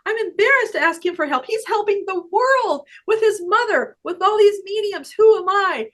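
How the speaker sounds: high, loud, incredulous self-talk; self-doubting protest. Retold or imitated. Acting out her self-doubting thoughts.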